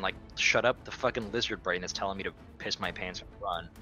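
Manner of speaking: ordinary talk, nothing marked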